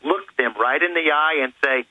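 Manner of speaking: ordinary talk, nothing marked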